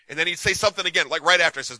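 ordinary talk, nothing marked

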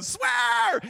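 high, loud, drawn-out egging-on; goading shout. Retold or imitated. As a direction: yell it like a coworker daring him. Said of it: "you got a guy over going".

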